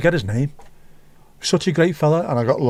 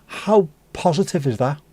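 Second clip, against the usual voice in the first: ordinary talk, nothing marked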